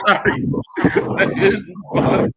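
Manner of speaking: high, loud, helpless laughter; laughing apology. Real, not acted. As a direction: confess through a burst of laughter. Cracks up admitting he skipped highlights.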